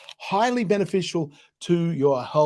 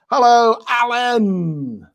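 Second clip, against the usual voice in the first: loud, high, drawn-out hail; calling out; 8 semitones higher, 8 dB louder, much slower than usual. Real, not acted. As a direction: sing out a big slow greeting. Greeting viewer Alan by name.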